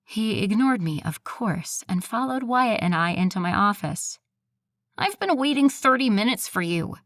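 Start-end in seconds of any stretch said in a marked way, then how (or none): 5.0-6.9 annoying nasal whine, higher pitched. Acted. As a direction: complain through the nose. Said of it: "in his annoying nasal voice".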